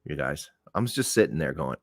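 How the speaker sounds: usual speaking voice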